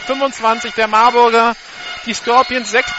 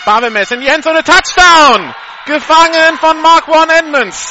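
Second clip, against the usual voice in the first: higher, louder shouted touchdown call; 7 semitones higher, 9 dB louder than usual. Real, not acted. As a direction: roar the score into the end zone. Live call of the winning touchdown.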